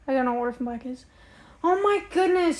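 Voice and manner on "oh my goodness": high-pitched fan excitement; excited exclamation. Real, not acted. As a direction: gush at being asked about a favourite show. Delighted to be asked about show.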